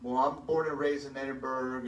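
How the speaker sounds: ordinary talk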